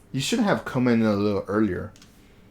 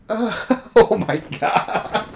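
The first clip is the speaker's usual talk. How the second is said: high, slow laugh through the words; can't stop laughing at it; 11 semitones higher, 3 dB louder, much slower than usual. Real, not acted. Laughing at awkward scene.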